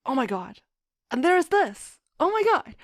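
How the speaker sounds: high, loud thrilled "oh my god"; excited gasp. Retold or imitated. Author recalling her idea, excitedly. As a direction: gush with sudden realization. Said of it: "So that's where I was like".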